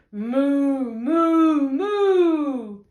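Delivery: loud drawn-out moo; duck mooing. A narrator's reading. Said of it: "the duck on the pond said".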